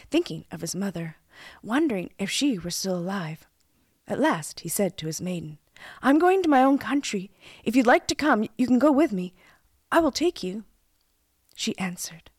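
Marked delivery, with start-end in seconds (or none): 6.0-10.6 higher, louder storybook prince; young hero making an open invitation; earnest prince. A narrator's reading. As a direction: offer it eagerly to the maiden. Prince invites his maiden.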